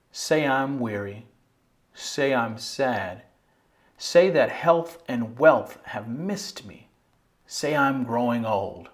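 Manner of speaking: slow, measured poem reading with pauses; annotated poem recital. Shown as a demonstration. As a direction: recite each phrase deliberately, leaving space after it. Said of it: "give it a quick run through".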